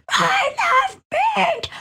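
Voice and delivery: high squealing shout. Retold or imitated. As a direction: shriek it out, slow and high. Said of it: "And Tara's just like".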